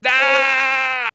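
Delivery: short loud groan of realization; the moment it all clicks, painfully; anguished cry. Real, not acted. Gamer realizing he rolled the wrong stat, anguished.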